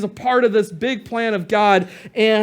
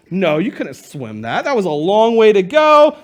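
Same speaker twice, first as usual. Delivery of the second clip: normal talking voice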